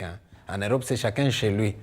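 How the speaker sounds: ordinary talk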